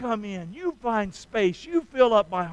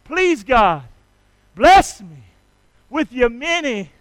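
loud, fervent prayer called out; praying aloud for the congregation to echo; prayer lead; 9 dB louder, slower than usual. Shown as a demonstration. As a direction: lead them in prayer with force. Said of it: "Say that with me".

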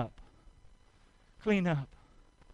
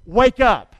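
loud, firm call to wake up; 10 dB louder than usual. Shown as a demonstration. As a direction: call it out to the congregation. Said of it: "Say that with me".

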